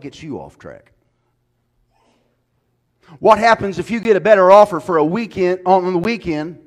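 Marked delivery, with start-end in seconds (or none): 3.2-6.6 fiery preacher building to a roar; preaching at full force. Acted. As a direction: thunder it from the pulpit. Preaching climax again.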